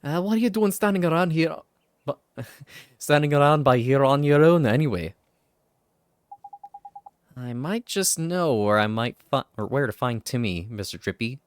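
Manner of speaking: a hint of Welsh lilt, chatty; lightly voiced Welsh Drippy. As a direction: banter along with a slight Welsh lilt.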